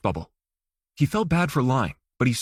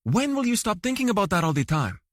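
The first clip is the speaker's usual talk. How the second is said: higher, mock-exasperated wife; 6 semitones higher than usual. A narrator's reading. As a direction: scold him playfully.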